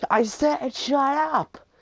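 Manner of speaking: slow, high-pitched mock shout; loud, exaggerated retold outburst; imitated angry yell. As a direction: mimic a furious classmate insisting, drawn out. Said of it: "angry as shit now".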